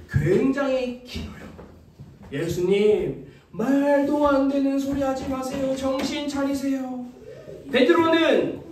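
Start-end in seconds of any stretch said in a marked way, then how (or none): none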